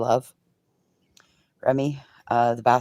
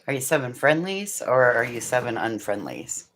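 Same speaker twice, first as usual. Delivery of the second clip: normal talking voice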